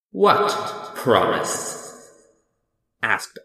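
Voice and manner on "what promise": very slow, loud demand; imposing wizard questioning petitioners; booming Oz voice. Acted. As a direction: boom it out, stretching both words. The great Oz demands an answer.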